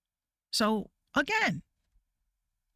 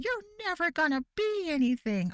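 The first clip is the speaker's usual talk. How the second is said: much higher, sneering bully voice; mock video bully; 10 semitones higher than usual. Streamer mocks a Dhar Mann bully, much higher. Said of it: "Dhar Mann videos".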